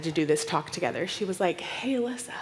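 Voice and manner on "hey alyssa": softer, whispered heads-up; confiding whisper. Retold or imitated. Layla quietly warning her friend, hushed whisper. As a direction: lean in like sharing a secret.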